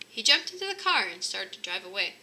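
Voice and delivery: plain reading voice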